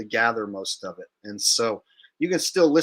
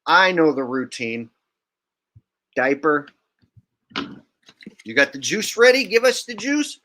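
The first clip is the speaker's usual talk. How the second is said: mocking eager people; 4 semitones higher, 6 dB louder than usual. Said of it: "People will be like".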